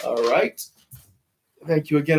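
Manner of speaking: ordinary delivery, nothing marked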